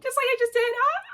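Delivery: real ecstatic squeal; thrilled shriek. Real, not acted. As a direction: shriek with amazed delight. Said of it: "I am shocking myself".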